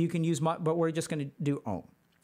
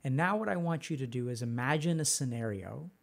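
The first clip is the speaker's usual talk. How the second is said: guided meditation; 5 semitones lower, 6 dB quieter than usual. Acted. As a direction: lead them gently through memory. Leads a meditation.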